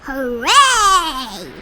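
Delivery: loud triumphant cheer; shouted hooray. A narrator's reading. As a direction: crow it out on top of the world. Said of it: "shouted Yertle".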